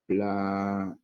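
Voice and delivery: "planeta" slowly split as pla, ne, ta; teacher's drawn-out breakdown of "planeta"; syllable by syllable. Shown as a demonstration. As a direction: sound out "planeta" with gaps between syllables. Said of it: "Vemos que es una palabra grave".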